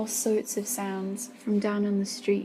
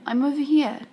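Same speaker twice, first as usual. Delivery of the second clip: calling out; 5 semitones higher, 8 dB louder than usual. A narrator's reading. Calling out to Stefan.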